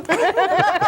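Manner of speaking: high giggling laugh; burst of high laughter. Real, not acted. Host stuck in inflatable suit, laughing high.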